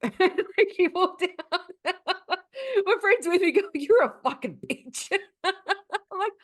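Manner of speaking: can't stop laughing while retelling it. Real, not acted. Laughing over her own comeback.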